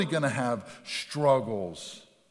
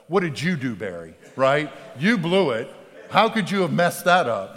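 louder mock ribbing; friends' teasing; 2 semitones higher, 8 dB louder than usual. Band friends teasing Barry about the breakup, mock loud. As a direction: needle him like the band guys would.

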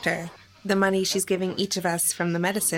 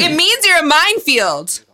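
louder and higher, clipped retort; loud, higher-pitched angry retort; angry shout; 7 semitones higher, 7 dB louder than usual. Acted. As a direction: throw it back in her face. Shouting back in argument.

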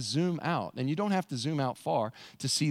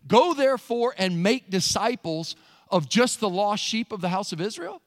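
"just the lost sheep" as a raised, doubting question; mock-questioning misquote; 4 semitones higher, 5 dB louder than usual. Shown as a demonstration. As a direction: lift "Israel" into a question. Deliberate misquote posed as a question.

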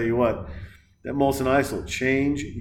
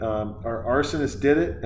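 ordinary talk

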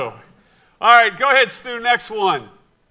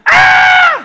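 very high, loud mock shriek; hammed-up scream of fright; imitated terrified scream; 11 dB louder than usual. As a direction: scream like his startled wife. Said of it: "And she's like".

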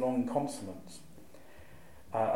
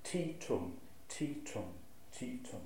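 "Ti tum" with a short light "Ti" and a long stressed "tum", softer; iambic rhythm; 10 dB quieter than usual. Shown as a demonstration. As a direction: tap out "Ti tum" short then long, quietly. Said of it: "It's a short, long".

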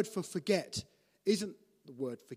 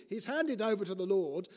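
ordinary talk, nothing marked

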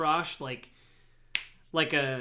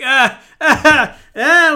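put-on high, loud wailing cries like a hurt kid; goblin crying scream; 11 semitones higher, 17 dB louder, much slower than usual. Wounded goblin on the ground, high loud wailing cries. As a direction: wail like a wounded child. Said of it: "starts screaming and crying".

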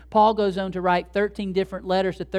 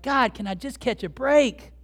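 higher pleading complaint, "break" swung out; exasperated plea; 6 semitones higher, 4 dB louder than usual. Acted. Believer asking God for relief, exasperated. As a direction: plead with heaven, fed up.

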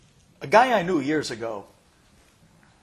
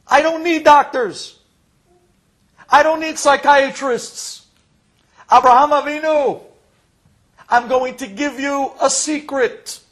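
loud, high, slow preaching; booming proclamation; 8 semitones higher, 13 dB louder, slower than usual. Acted. Preacher voicing the Torah's message to Abraham, booming proclamation. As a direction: proclaim it grandly, drawing out each phrase.

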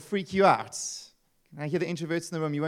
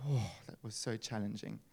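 mock weary sigh; 7 semitones lower, 13 dB quieter than usual. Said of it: "I'll walk away going".

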